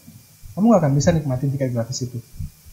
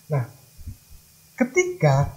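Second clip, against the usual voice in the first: usual speaking voice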